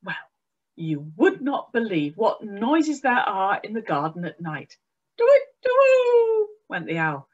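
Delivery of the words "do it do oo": very high, loud hooting owl. A narrator's reading. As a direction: hoot it like a night owl.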